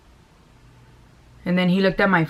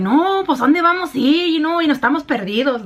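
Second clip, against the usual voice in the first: put-on playful Mexican accent, pitched up; Mexican Spanish accent; 8 semitones higher than usual. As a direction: ham up how the girls talk in Mexican Spanish. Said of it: "we talk very Mexican".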